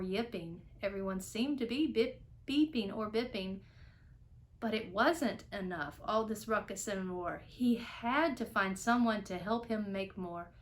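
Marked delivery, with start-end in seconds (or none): none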